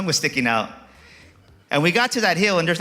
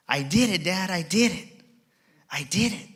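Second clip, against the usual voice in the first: put-on joyful kid; 4 semitones higher than usual. Son's tears of joy.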